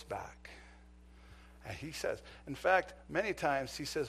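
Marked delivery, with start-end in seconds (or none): none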